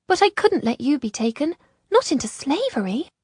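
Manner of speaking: high female character voice; high, heartfelt explanation; earnest Isolde. A narrator's reading.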